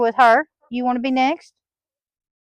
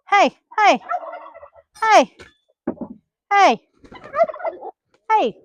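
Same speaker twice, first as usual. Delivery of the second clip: sharp warning shouts; 9 semitones higher than usual. Real, not acted. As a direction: yell hey to stop the fight. Breaking up a hen fight.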